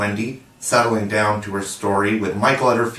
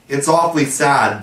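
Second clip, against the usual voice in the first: cheerfully; 5 semitones higher, 4 dB louder than usual. A narrator's reading. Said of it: "the first twin said cheerfully".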